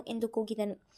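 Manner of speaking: plain reading voice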